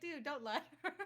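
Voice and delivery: laughing while telling him off; laughing scold. Real, not acted.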